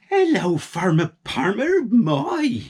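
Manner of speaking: high, bright greeting; friendly shop lady. A narrator's reading. Ice cream lady greeting the farmer. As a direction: sing out hello.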